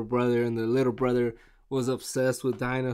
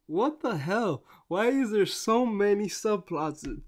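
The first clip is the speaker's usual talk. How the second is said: higher, fed-up complaint; exasperated; 7 semitones higher than usual. Retold or imitated. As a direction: throw up your hands at the subplots. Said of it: "So I'm like".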